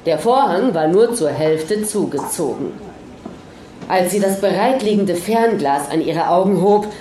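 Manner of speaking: ordinary delivery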